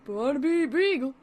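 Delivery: bright goofy dog voice, punched; Barnaby voice. Acted. Barnaby introducing himself loudly to the host. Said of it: "how am I gonna do Barnaby?".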